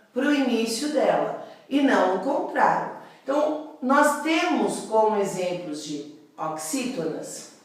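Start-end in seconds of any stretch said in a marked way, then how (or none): none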